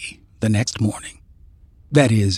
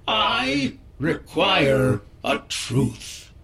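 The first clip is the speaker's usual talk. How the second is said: slow command; 5 semitones higher, 4 dB quieter, much slower than usual. Acted. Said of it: "she commands".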